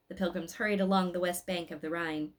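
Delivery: ordinary delivery, nothing marked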